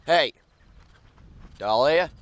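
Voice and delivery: loud call to the dog. Real, not acted. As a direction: call her name across the yard. Calling the dog.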